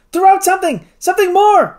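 high, loud, desperate order; desperate cry. A narrator's reading. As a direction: shout it urgently to save the balloon. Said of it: "cried the doctor".

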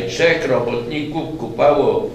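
ordinary delivery, nothing marked

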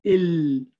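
"ال" said slowly with the lam held, tongue on the ridge; Arabic lam demo. Shown as a demonstration. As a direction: sustain the L of "ال" so the air partly flows. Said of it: "Try to say ال".

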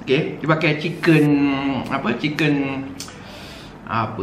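speaking before taking bite; talking with noodles at lips. Real, not acted. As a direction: noodles held near mouth. Seen 0: chopsticks hold noodles right at his lips while he talks.

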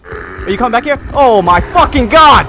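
high, loud frantic yelling; frantic panic. Real, not acted. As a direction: panic as the enemy closes in.

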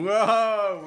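loud, high wowed exclamation; high, impressed whoa; amazed whoa. Real, not acted. Amazed at child's trick.

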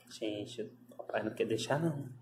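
soft, low and quick confiding aside; hushed aside. Real, not acted. Owner confiding quietly to viewers about the bossy dog. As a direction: tell the viewers quietly and fast.

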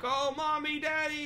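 high mock child calling for parents; high whining kid imitation; whiny kid voice. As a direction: imitate bratty kids whining. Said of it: "the kids are like".

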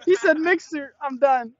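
high-pitched, laughing disbelief; helpless amused squeal; cracking up. Real, not acted. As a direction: say it barely over your laughter. Cracking up after the Mixer answer.

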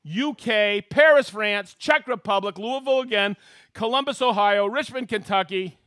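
loud, high announcer shout-outs; excited host roll call. Acted. Host hailing viewers watching from around the world, excited roll call. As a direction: call out each place like a rowdy emcee.

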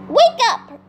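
high, urgent call to sleepers; yelled wake-up call. A narrator's reading. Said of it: "she yelled".

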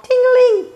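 very high, loud ting-a-ling; bell imitation. A narrator's reading. Froggy pedals like mad, loud high bell. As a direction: ring it out like a bike bell.

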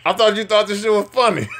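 giggling disbelief; laughing while talking. Real, not acted. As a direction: crack up as you say it. Laughing at mom's switch.

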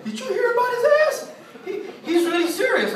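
very high, squeaky mock giggler; giggling gossip. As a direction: imitate gigglers gossiping at the back. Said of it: "everybody in the back giggling".

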